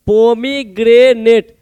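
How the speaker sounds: Pomegranate said slowly, in separate chunks; broken into syllables. Shown as a demonstration. Teacher showing students how to remember it, pomegranate slowly broken up. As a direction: draw out each part of pomegranate for memorising. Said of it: "तोड़, तोड़ का ऐसे याद रखेंगे".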